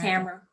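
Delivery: Tamara said TAM-ruh; stress on first syllable. Real, not acted. Speaker repeating the corrected name, Tamara as TAM-ruh. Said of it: "is it Tamara or Tamara".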